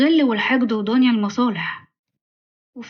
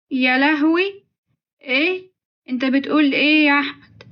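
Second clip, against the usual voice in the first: higher-pitched, alarmed disbelief; shocked; 3 semitones higher than usual. Acted. Mother hearing of her son's debt, shocked. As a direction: react aghast, pitch lifting.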